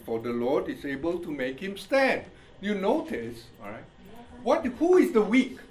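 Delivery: ordinary talk, nothing marked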